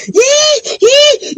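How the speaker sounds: high squeaky dog whine; high puppy whimpers; dog whining. Retold or imitated. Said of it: "he whined a little bit".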